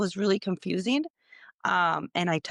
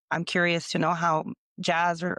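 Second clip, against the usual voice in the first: ordinary talk, nothing marked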